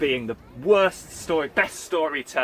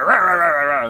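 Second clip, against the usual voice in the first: loud, higher barking rahs; dog barking; 3 semitones higher, 11 dB louder than usual. Retold or imitated. Big dog charging at orcs, loud barking. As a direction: bark like a big guard dog chasing raiders. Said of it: "he'd go".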